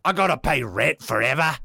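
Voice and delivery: put-on British grumble; British accent. Twin brother complains about rent, mock British accent. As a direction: moan it in a comic British accent. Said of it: "Isukiri has a British".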